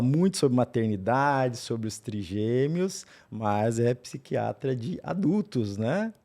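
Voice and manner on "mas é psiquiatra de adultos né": ordinary talk, nothing marked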